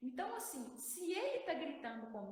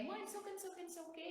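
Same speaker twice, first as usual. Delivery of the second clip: high-pitched nagging kid imitation; mock whiny rattled-off demand; demanding child; 6 semitones higher, much faster than usual. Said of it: "falando de uma forma que eu não gostaria de ouvir".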